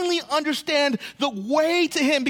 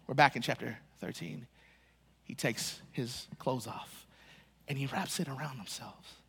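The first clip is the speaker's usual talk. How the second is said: much softer, drawn in close; hushed; 11 semitones lower, 14 dB quieter than usual. Real, not acted. Pastor draws listeners close as Jesus undresses, hushed. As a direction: lower the voice as if sharing something sacred. Hushed retelling of foot washing.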